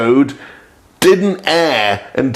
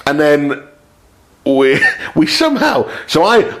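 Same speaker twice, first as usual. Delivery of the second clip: ordinary talk, nothing marked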